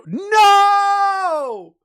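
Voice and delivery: high loud shout. Real, not acted. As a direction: yell the no. Sudden refusal shout.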